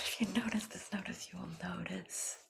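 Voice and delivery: quick whispered tongue twister. Shown as a demonstration. Coach whispering the notice tongue twister. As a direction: rattle it off in a whisper. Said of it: "here's one".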